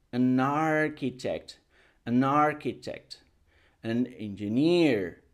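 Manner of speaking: "an architect" spoken slowly with "an" joined straight onto the noun; slow linked demo. Shown as a demonstration. Teacher slowly modelling the linked "an" for students. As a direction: link "an" to the word, slowly. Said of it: "Vamos a decir an architect".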